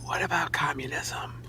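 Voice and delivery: soft whisper. Acted. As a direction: whisper the question. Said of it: "Why are we whispering this?".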